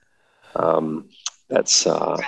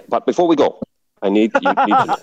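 mock Thai accent; 6 semitones higher than usual. Dive guide warning tourists about seasickness, in a hammed-up Thai-Indian accent. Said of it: "it'll end up Indian".

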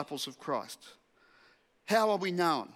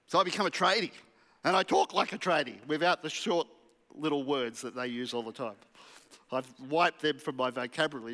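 ordinary delivery

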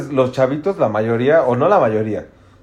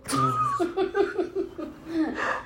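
long high-pitched burst of laughter; 9 dB quieter than usual. Real, not acted. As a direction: let the laugh run out high. Breaks into laughter again. (carcajada aguda y larga)

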